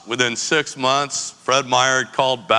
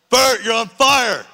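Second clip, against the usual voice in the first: high, loud, slow alarmed yell; alarmed shout; 11 semitones higher, 4 dB louder, slower than usual. Retold or imitated. Telling Bert his ass is on fire, slow high yell. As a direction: warn him his pants are burning. Man's pants catch fire.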